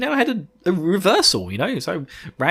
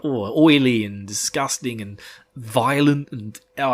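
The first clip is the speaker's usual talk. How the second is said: usual speaking voice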